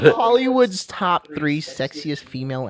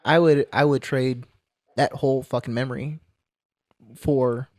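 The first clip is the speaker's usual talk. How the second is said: sad, quiet; 5 semitones lower, 7 dB quieter than usual. Real, not acted. Grief over lost friend.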